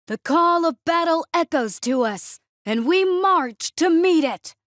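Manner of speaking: high, loud sergeant's chant; rallying battle cry. A narrator's reading. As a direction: shout it to rally the troops.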